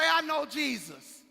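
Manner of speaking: accusing. Acted. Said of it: "Next person say".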